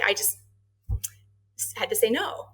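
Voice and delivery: normal talking voice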